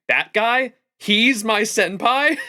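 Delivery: drawn-out, disbelieving question with a laugh behind it; Jalter. Acted. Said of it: "she's got a My Room line where she says".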